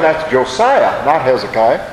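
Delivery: ordinary delivery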